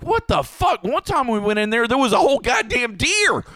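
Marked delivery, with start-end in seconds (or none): 0.0-0.8 high, startled disbelief; shocked outcry. Retold or imitated. Finding bullets in frozen duck, shocked outcry. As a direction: jump up in pitch at the sight. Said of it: "I'm like".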